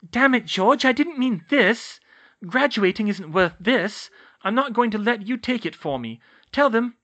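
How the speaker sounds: high, loud, frustrated urging; exasperated pleading. A narrator's reading. Hermione pleads angrily with George.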